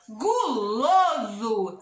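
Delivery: "Guloso" stretched very long by syllable; slow syllable demo. Shown as a demonstration. Teacher giving children a gu word, very drawn out. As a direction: sound out "Guloso" very slowly.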